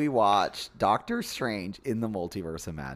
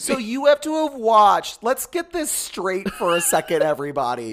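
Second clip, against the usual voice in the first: high, loud incredulous outburst easing slightly; exasperated rant; 7 semitones higher, 6 dB louder than usual. Real, not acted. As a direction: hammer out the homework list in disbelief. Ranting about required prior viewing.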